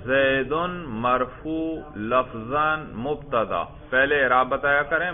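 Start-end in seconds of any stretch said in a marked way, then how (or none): 0.0-3.6 slow, measured parsing chant; slow teacherly recitation; model recitation. Shown as a demonstration. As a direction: recite it term by term, drawn out.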